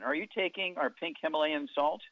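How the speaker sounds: ordinary delivery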